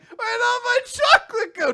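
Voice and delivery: high, loud wail of dismay; wailing cry. Retold or imitated. Girl wailing that her chocolate vanished. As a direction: wail it like a kid losing candy.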